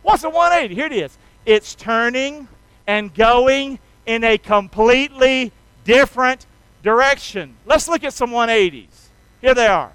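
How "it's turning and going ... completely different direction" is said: slow, louder and drawn out; slow and emphatic. Shown as a demonstration. A preacher leading the congregation in a slow, emphatic call-and-response. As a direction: lay out each word slowly. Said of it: "Can y'all say that with me?".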